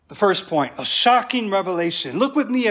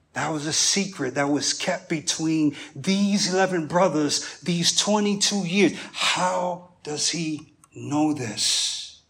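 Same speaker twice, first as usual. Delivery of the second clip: ordinary talk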